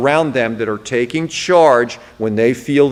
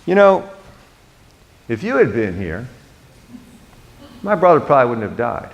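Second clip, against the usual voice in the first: offhand shrug; 3 semitones lower, faster than usual. Shown as a demonstration. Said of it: "I don't think she says it like this".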